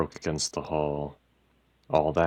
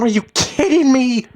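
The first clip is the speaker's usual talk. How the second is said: very high, loud screech; incredulous, furious shriek; outraged disbelief; 13 dB louder than usual. A narrator's reading. Wife outraged in quarrel.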